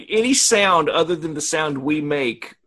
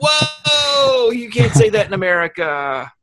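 mock shocked; 8 semitones higher, 3 dB louder, slower than usual. Coworkers reacting shocked.

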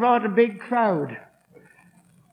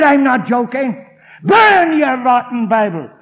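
loud, hard demand; angry insistence; 2 semitones higher, 8 dB louder than usual. Retold or imitated. Heckler repeats his demand, loud and angry. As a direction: push it at him.

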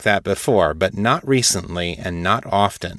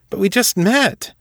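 flustered protest; 12 semitones higher than usual. Acted. Jessup protesting in alarm.

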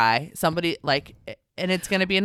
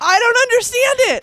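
loud, high wail of bafflement; exasperated outburst; 7 dB louder than usual. Real, not acted. Mom venting to another mom about boys, exasperated outburst. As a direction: burst out at a much higher pitch, fed up.

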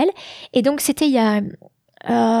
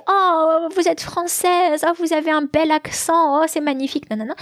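imitated gushing admirers; 6 semitones higher than usual. Everyone complimenting a French woman's English accent, mock-enraptured. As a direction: coo in imitation of charmed admirers.

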